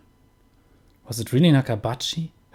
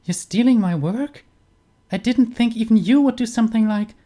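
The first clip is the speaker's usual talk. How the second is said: put-on much higher girl's voice, disbelieving; shocked young woman; 11 semitones higher, 4 dB louder than usual. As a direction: rise up high as the stunned daughter. Daughter accuses father in game.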